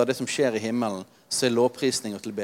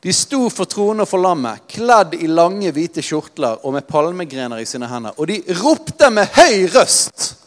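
reading louder and higher, gaining force; preaching the heavenly crowd, growing louder; building preaching; 5 semitones higher, 7 dB louder than usual. Acted. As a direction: build the scene with rising fervour. Building intensity reading scripture.

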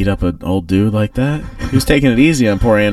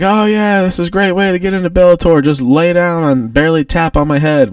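mocking taunt; 8 semitones higher than usual. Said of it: "He's like".

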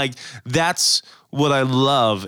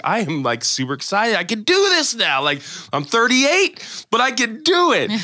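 higher, rising excitement; a grown man quoting his own hype at the skate park, higher and pumped; 10 semitones higher than usual. Retold or imitated. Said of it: "I'm like".

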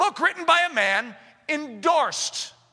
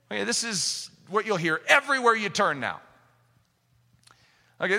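normal talking voice

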